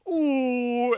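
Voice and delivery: high, loud sappy ooh; loud high sentimental coo; mock longing coo.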